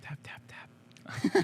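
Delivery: slow playful whisper. Pretend. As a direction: whisper it like a secret signal. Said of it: "Tap the mic three times".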